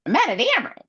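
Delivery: high put-on angry voice; mock angry Nesta. Nesta snapping at Feyre, high and put-on. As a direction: snap it, hammed-up. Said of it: "Nesta looks at her and goes".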